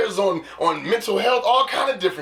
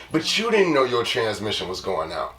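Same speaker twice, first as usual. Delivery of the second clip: ordinary delivery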